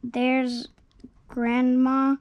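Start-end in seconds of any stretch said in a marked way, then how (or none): none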